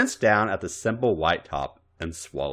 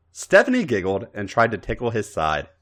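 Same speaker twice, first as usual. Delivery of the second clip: plain reading voice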